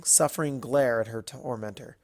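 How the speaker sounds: usual speaking voice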